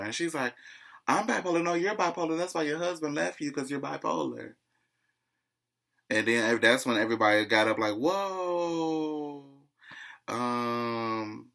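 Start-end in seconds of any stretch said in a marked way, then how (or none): 1.1-4.6 high-pitched bickering comeback; high retold quarrel voice; mock retort. 7.9-9.5 high, drawn-out whoa; stretched group exclamation; mock alarm. Everybody jumping up, imitated.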